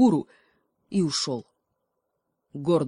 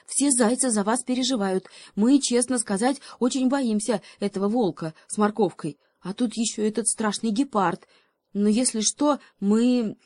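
higher, timid little voice; a brave but scared messenger; nervous hare; 6 semitones higher, 3 dB louder than usual. Acted. Hare asking the hedgehog, higher and timid.

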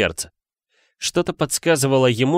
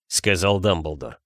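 ordinary delivery, nothing marked

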